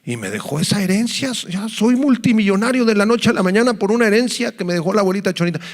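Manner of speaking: a newly rich believer bragging to his pastor; voicing a believer.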